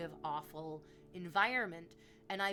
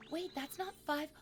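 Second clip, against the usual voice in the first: high, gasping double-take; startled disbelief; 9 semitones higher than usual. Real, not acted. Streamer spots an unexpected donation, startled disbelief. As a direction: catch your breath and question it, pitched way up.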